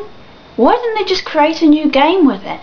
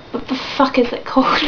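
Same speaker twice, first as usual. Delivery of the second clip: normal talking voice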